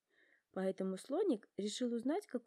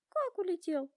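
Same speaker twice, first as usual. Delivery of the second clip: high, louder astonished question; surprised giraffe; 10 semitones higher, 4 dB louder than usual. A narrator's reading. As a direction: gasp it out in surprise, pitched up. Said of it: "удивилась Жирафа".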